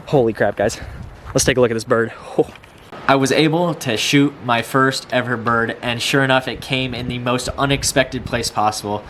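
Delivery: ordinary talk, nothing marked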